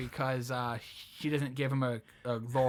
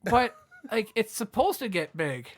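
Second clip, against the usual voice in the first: higher, a bit louder protest, laughing at the end; mock indignant; 5 semitones higher, 4 dB louder than usual. Him to his girlfriend, mildly mock indignant. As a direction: protest like a wounded ego. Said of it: "And I'm like".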